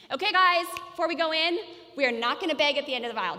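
loud, forceful parental order; firm, loud announcement to the kids; compliance statement. Shown as a demonstration. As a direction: lay down the rule, rushing the end. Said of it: "That's a compliance statement".

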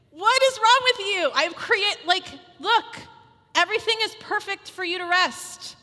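high, loud frustrated mom; fed-up half-laughing rant; exasperated scolding. Retold or imitated. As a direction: vent at the kids who wrecked the room.

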